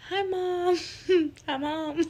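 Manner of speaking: high, put-on little voice through laughter; squeaky-high awkward hello, half laughing; sheepish greeting. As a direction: imitate yourself caught by your mom, giggling. Sheepish greeting to mom, acted out.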